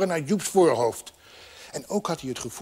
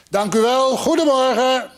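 high, loud greeting; raised, carrying good-morning call; polite street call; 8 semitones higher, 6 dB louder than usual. Acted. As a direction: call thanks out loudly, pitched up. Voice calling from street below.

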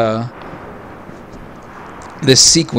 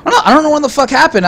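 ordinary talk

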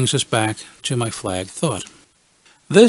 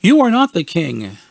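put-on challenging kid; 4 semitones higher, 5 dB louder than usual. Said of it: "they challenged".

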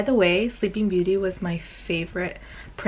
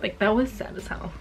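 usual speaking voice

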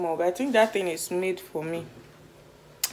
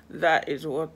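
ordinary talk, nothing marked

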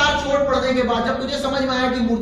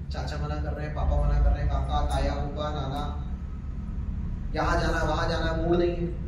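mock excuses; 8 semitones lower, 7 dB quieter than usual. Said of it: "हजार बहाने ready होते हैं".

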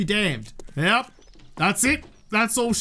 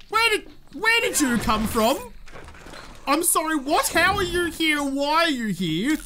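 startled; 6 semitones higher than usual. Real, not acted. Surprised by pet appearing.

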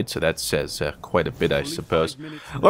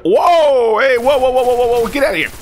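high, loud repeated whoas; shocked yelling; 12 semitones higher, 6 dB louder than usual. Real, not acted. Streamer yells at a surprise attacker, loud and high. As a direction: blurt it out in real shock.

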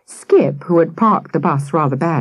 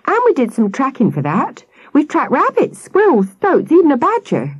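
put-on high, eager boy boasting to the policeman; a keen cub showing off; proud young boy; 11 semitones higher than usual. Sam the cub boasting to the policeman, high and eager.

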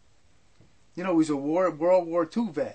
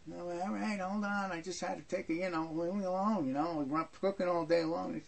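mumbling; 8 dB quieter than usual. Retold or imitated. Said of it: "She's mumbling this".

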